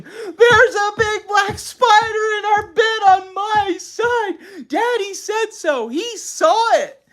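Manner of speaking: slow, high-pitched wailing yell. A narrator's reading. Mother pounding on the bed about a spider, yelling. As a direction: draw out each word in a shrieking panic. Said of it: "began pounding on the bed and yelling".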